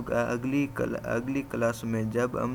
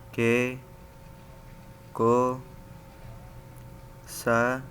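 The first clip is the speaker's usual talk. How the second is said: chart recital; much slower than usual. Shown as a demonstration. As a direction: call out each kana clearly for the class. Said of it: "ابھی میرے ساتھ پڑھیے".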